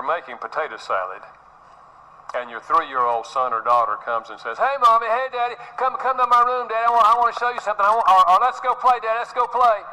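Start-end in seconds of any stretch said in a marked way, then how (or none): 4.6-9.8 put-on high, eager little-kid voice pleading to play; small child begging. Three-year-old begging mommy and daddy to come play, high and eager. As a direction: chirp it out like a toddler tugging at a parent.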